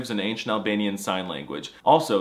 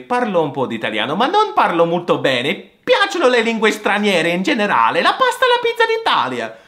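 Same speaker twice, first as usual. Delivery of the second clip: Mario-style Italian; 7 semitones higher, 6 dB louder than usual. Acted. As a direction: ham it up like Mario. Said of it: "stereotypical like Mario and Luigi".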